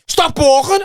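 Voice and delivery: shrill-pitched retold order; mock shout at a patient; doctor's scolding shout. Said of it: "the doctor was going".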